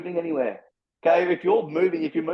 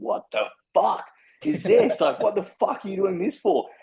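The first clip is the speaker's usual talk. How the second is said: incredulous stranger; 5 semitones higher than usual. Retold or imitated. Strangers online reacting to his cold shower video, higher and slowed in disbelief. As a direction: play a stranger gobsmacked by the video.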